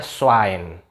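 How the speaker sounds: "Schwein" begun with a thin s, as swain; plain s onset. Shown as a demonstration. Teacher reading S W spelling for viewers, plain s. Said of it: "kalau S W I N doang".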